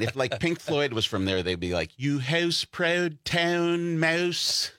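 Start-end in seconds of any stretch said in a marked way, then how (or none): 2.0-4.7 very slow, higher, hammed-up Canadian accent. Pink Floyd if they were from Canada, mock Canadian accent. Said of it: "the Canadian accent".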